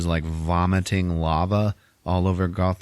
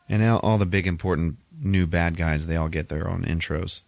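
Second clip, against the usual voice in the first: usual speaking voice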